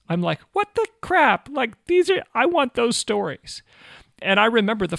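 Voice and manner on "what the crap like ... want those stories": high, exasperated mock outburst; retold envy, pitched up high and louder; jealous outburst. Missionary envying his friends' stories, jealous outburst. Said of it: "I got jealous".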